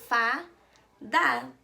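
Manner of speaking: "fada" split into fa, pause, da; syllable by syllable. Shown as a demonstration. Teacher revealing the word to children, syllable by syllable. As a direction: sound out "fada" in two separate beats. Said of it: "quando eu leio de sílaba em sílaba".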